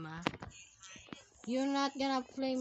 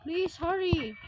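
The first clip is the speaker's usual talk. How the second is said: raised, louder urging; urgent plea; 4 semitones higher, 4 dB louder than usual. Real, not acted. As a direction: plead out loud for speed. Urging the game on.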